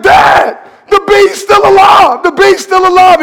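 high, loud scared yelling; child screaming about a bee; panicked kid. Acted.

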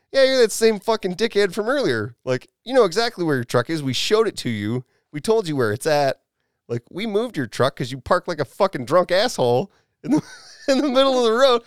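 high, loud exasperated scolding; retold chewing out. Speaker lays into the drunk about his moved truck, retold chewing out. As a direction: act out laying into a culprit.

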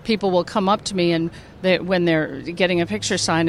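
usual speaking voice

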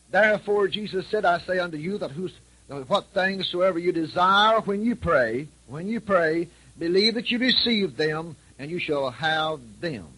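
scripture reading. Acted. Preacher reading Mark to the congregation, slow and solemn. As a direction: proclaim the verse slowly.

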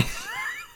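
breathy laugh burst; sudden laugh; burst of laughter. Real, not acted. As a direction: crack up laughing. Laughing at dumb trivia.

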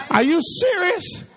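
high-pitched disbelieving question; comic astonishment, pitch climbing; mock incredulous. Preacher imagines Jesus answering Martha's demand, comically incredulous. Acting out a taken-aback Jesus.

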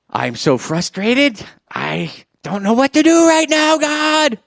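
hammed-up cry to God, climbing very high; frustrated outburst. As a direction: build from drawn-out exasperation to a loud high wail. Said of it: "I've been in places where I'm like".

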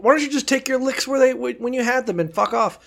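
high, sneering scold; mocking cop voice. Mocking cops scolding Job.

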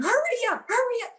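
high, urgent mock holler; imitated yelling.

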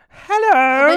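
loud, high-pitched, over-positive hello; exuberant greeting. Retold or imitated. Imitating the cafe owner greeting regulars, exuberantly positive. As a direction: belt out a hugely cheery greeting. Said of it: "she's just so positive".

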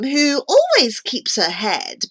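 usual speaking voice

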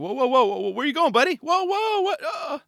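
high, loud alarmed whoas; a cyclist yelping at a skater shooting past; startled cyclist. Retold or imitated. Cyclist startled by Clive, high loud whoas. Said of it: "he was probably thinking".

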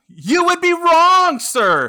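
slow, high, loud gloat. Real, not acted. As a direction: rub it in triumphantly. Gloating correction.

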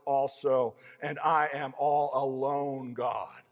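loud, high, desperate prayer to God; impassioned lament. Acted. Prophet crying out to God, all alone, loud and anguished. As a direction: cry out to God in despair.

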